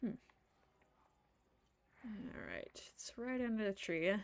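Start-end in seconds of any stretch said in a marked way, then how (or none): 2.1-3.1 soft whisper to yourself. Real, not acted. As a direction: murmur it under your breath. Whispering to herself mid-game.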